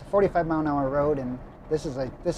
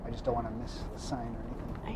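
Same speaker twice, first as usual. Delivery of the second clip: whispering; 3 semitones lower, 8 dB quieter than usual. Real, not acted. Driver anxious, whispering while driving.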